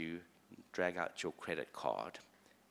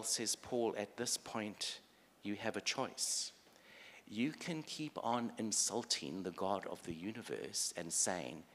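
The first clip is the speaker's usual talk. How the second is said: ordinary talk, nothing marked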